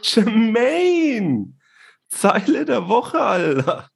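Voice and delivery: drawn-out hype shout for the line of the week; excited hype call. Real, not acted. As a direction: hype the segment up like a crowd call. Hyping up the category jingle.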